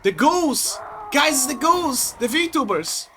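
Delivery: high excited call to the guys; excited alarm. Real, not acted. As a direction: point them out in a rush. Enemies appear, calling out.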